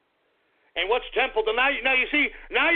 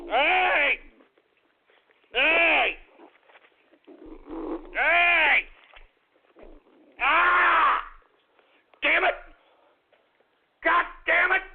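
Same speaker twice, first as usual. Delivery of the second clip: higher, sharp alarmed shouts; startled yelling; 6 semitones higher, much slower than usual. Real, not acted. Owner shouting at his snarling dog, alarmed yells. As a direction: yell out in alarm as the dog snaps.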